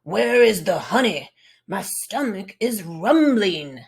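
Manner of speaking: loud growling bear; grizzly bear voice. Acted. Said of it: "You would speak very deep, growling and grumbling".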